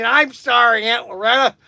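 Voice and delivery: imitated tipsy pleading; tipsy apology. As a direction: apologize like a maudlin drunk leaning on someone. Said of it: "my head on her shoulder".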